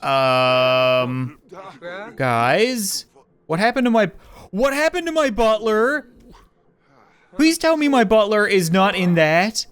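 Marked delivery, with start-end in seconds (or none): none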